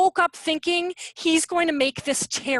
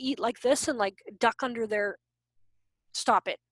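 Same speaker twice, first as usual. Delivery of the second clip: ordinary delivery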